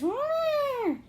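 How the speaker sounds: high, loud, held elephant trumpet; elephant roar. Shown as a demonstration. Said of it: "And he goes".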